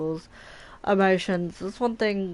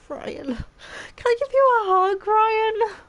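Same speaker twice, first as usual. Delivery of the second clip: teary; 5 dB louder, slower than usual. Real, not acted. Streamer moved by game scene.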